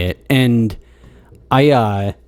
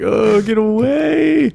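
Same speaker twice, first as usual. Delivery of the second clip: high, slow drawn-out yell; slow shout; 11 semitones higher, much slower than usual. Retold or imitated. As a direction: shout it away in disgust. Repeats line as shout.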